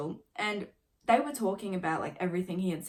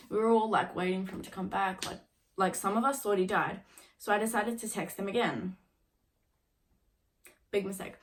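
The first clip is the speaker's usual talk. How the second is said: normal talking voice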